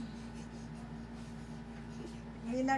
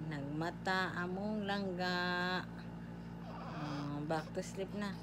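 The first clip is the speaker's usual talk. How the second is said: much softer and lower, hushed; 9 semitones lower, 12 dB quieter than usual. Real, not acted. Parent hushing beside a drowsy baby.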